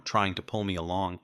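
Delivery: plain reading voice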